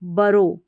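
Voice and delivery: बरो with a short first vowel, not बोरो; बरो said as buh-ro; correct pronunciation. Shown as a demonstration. Teacher models the right बरो for students. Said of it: "बोरो नहीं पढ़ना है".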